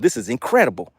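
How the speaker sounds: ordinary talk, nothing marked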